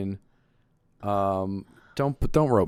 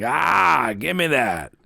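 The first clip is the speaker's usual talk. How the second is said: imagined grabby bear; 6 semitones higher, much slower than usual. Acted. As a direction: burst out as a bear demanding your stuff. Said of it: "What if there's a bear?".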